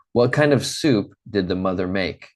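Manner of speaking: ordinary delivery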